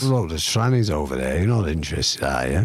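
lightly voiced deep Scouse. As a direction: rumble it like a Scouser. Mocking Scouse low voice.

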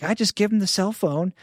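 clueless painter's breezy advice, mocked high; imitating the painter. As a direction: ham up a clueless house painter. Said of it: "He's like".

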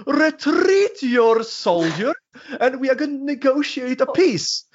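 high, slow, sneering bureaucrat voice; mock a stuffy UN envoy with disdain; snarky diplomat. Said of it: "stereotyp som pratar".

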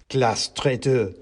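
slow accusation at normal volume; understated mouse shout. A narrator's reading. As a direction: accuse in character, without real shouting. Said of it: "shouted the mouse in the taxi".

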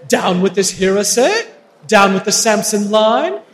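loud rallying denunciation; defiant battle cry. Acted. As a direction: cast a spell with full theatrical force. Villain denounces and casts spell.

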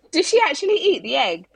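high, quick astonished quote; incredulous question. Retold or imitated. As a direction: replay your disbelief at the nursery worker. Acting out her astonished question.

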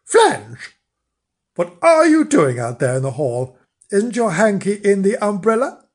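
loud, impatient call; nagging wifely summons, loud. A narrator's reading. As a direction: call out sharply to someone in another room. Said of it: "called out impatiently".

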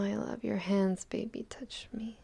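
usual speaking voice

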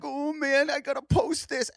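high, hammed-up weepy voice; mock bawling. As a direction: imitate a man gushing through tears. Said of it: "And he's bawling".

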